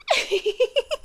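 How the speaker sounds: brief high airy chuckle; soft amused laugh. Acted. Monster laughs at the uneasy human, airy and amused. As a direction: laugh softly at their discomfort.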